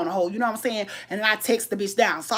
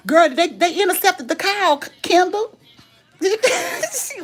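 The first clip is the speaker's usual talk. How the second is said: high, excited, breaking into laughter; laughing; 8 semitones higher, slower than usual. Real, not acted. Telling viewers the call got cut off, laughing. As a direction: crack up while trying to tell it. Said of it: "'cause I got to laugh".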